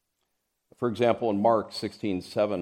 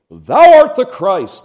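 high, loud, ringing declaration; bold proclamation; 10 semitones higher, 17 dB louder, slower than usual. Acted. Preacher voicing Peter's bold confession, loud and high. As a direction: proclaim it boldly, slow. Acted bold profession of faith.

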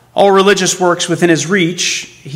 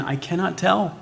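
soft, grieving; 8 dB quieter than usual. A narrator's reading. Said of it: "groaned the stricken man".

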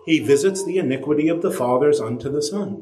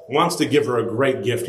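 usual speaking voice

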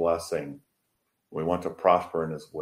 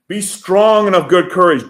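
much higher and louder, strong pressed hard; forceful exhortation; 10 semitones higher, 10 dB louder than usual. Shown as a demonstration. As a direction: shout "strong" as a rallying cry. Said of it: "And again".

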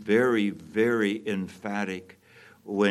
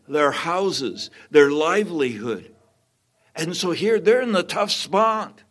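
higher, louder preaching push; fervent preaching; 6 semitones higher, 5 dB louder than usual. Acted. Pastor urging the congregation, preaching with fervor. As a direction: drive the persecution story home to the church.

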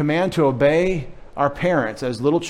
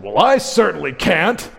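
higher-pitched, mock-indignant sarcasm; exaggerated sarcastic reply; sarcastic retort; 4 semitones higher, 4 dB louder than usual. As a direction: play a sarcastic kid talking back. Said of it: "sarcasm's a little bit".

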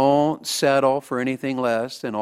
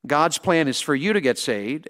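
ordinary talk

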